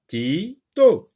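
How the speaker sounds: teacher counting out the syllables of "Tito"; split into syllables. Shown as a demonstration. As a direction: break "Tito" after "Ti", each syllable held. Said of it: "on va diviser le mot Tito en syllabes".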